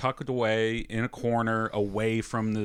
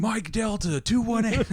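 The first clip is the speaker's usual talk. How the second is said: soldier radio call; 11 semitones higher than usual. Pretend. Mocking PTSD flashback radio call.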